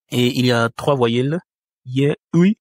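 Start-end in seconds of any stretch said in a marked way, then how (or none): none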